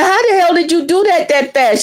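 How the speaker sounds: amazed question. Retold or imitated. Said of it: "I was like".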